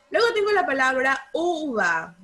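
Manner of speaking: ordinary delivery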